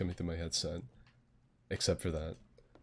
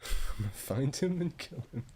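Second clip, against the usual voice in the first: mock axe murderer; 7 semitones higher than usual. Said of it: "went from really friendly to axe murder".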